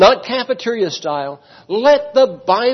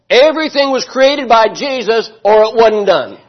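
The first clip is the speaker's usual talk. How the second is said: raised, louder preaching voice; fervent preaching; 5 semitones higher, 5 dB louder than usual. Acted. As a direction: drive the point home to the pews. Preaching the point home.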